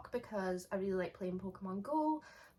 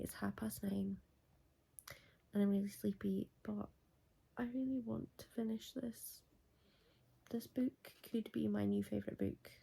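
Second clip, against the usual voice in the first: late-night whisper; 6 dB quieter than usual. Real, not acted. Updating viewers in bed at night, whispering. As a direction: keep it hushed so nobody wakes.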